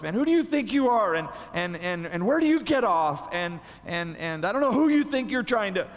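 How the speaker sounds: jab the questions like an offended teammate; indignant disciples. Retold or imitated. Angry disciples confronting two brothers.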